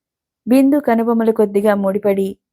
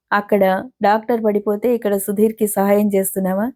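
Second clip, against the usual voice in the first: plain reading voice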